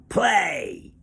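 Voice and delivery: sharp, high, loud command; ordered. A narrator's reading. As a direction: snap the order at the captive. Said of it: "she ordered".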